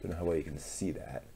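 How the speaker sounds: usual speaking voice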